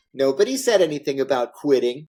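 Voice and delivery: usual speaking voice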